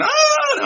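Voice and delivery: very high, loud mock scream; imitated freak-out scream. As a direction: shriek like people freaking out over bugs. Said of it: "they're all freaking".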